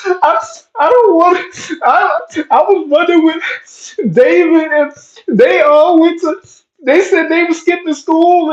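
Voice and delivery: put-on high, teary little-kid voice, words breaking; scared crying child. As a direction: plead like a frightened six-year-old about to be punished. Scared kid before a beating.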